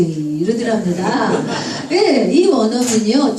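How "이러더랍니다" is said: ordinary delivery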